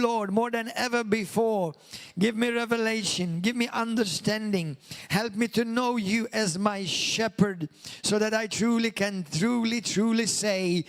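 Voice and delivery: earnest, projected prayer in measured phrases; fervent prayer. Real, not acted. Pastor leading the congregation in prayer, fervent and projected.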